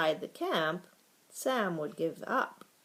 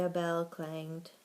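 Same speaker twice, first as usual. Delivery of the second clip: ordinary delivery, nothing marked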